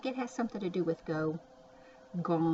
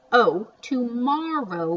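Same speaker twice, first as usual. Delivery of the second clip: realization; 4 semitones higher, 10 dB louder, slower than usual. Shown as a demonstration. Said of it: "looks like tomorrow".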